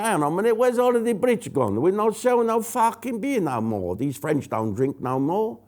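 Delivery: put-on high-pitched, failed Spanish accent; bad Spanish accent. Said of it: "I can't do a Spanish accent".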